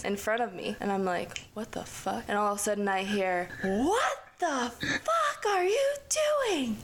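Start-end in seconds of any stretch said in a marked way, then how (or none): 1.5-2.2 soft, under-the-breath mock disbelief; hushed reaction. Storyteller spots a shadow at the window, hushed. 3.6-6.8 high, drawn-out mock screech; mock furious mom. Mom catches girls climbing in, mock shrieking. Voicing the mother catching them.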